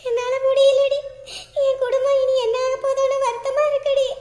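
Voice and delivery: high, tearful wail of despair; tearful lament. Acted. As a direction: cry it out like a heartbroken woman. Woman weeping over her family. (அழுகையுடன் புலம்பல்)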